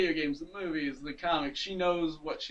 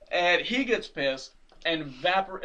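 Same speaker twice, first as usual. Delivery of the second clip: normal talking voice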